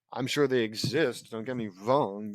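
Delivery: ordinary delivery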